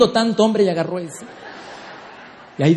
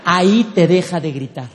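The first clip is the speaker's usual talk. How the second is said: ordinary delivery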